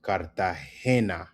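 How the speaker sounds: slow "Cartagena", kar-ta-HE-na; emphasis on HE. Shown as a demonstration. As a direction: stretch the HE of "Cartagena". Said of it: "Emphasis on the he".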